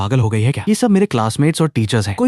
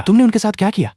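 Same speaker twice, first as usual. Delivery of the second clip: startled, pitched well up; startled question; 9 semitones higher, 3 dB louder than usual. A narrator's reading. Boy questions fox girl about silenced voices, startled. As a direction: ask it in sudden shock. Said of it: "मैंने चौंक कर पूछा".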